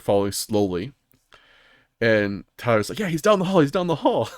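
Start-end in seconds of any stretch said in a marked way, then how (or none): 3.0-4.3 high-pitched imitated teammate alert; urgent, repeated mock warning; urgent callout. Teammate calling out an enemy's position, urgent.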